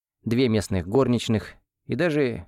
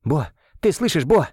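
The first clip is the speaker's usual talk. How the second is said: urgent breathy call, higher; breathed out; 6 semitones higher, 3 dB louder than usual. Acted. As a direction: breathe the name out over him. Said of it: "выдохнул я".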